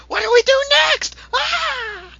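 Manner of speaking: shrieked, very high panic; overreacting freak-out. Tachibana arguing with Takarada, overreacting freak-out. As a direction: scream it in hammed-up panic. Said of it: "him overreacting and freaking out".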